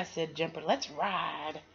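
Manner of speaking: put-on bright, lively reading to children; storybook read-aloud. A narrator's reading. Reader sharing a picture book with kids, lively storybook voice. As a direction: read it like bedtime story time.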